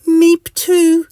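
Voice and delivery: very high, loud squeak; Mister Itch. Acted. Mister Itch answers the departing mosquito, high squeak. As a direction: squeak it back loudly. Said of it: "says Mister Itch".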